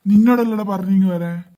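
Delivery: angry shout. Acted. Homeowner yelling at the thief to come over. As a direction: shout him over, fed up.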